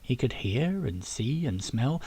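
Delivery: ordinary delivery, nothing marked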